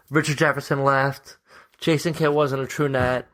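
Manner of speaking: low, solemn mock eulogy; somber voice. Host paying tribute to a departing player, put-on somber. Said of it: "get in my somber voice".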